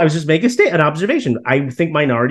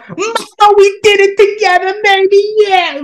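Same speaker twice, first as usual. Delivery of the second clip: high, squealing parody of the cheering family; mock them with a shrill cheer; mock screaming graduate; 4 dB louder, slower than usual. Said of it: "You don't have to scream out".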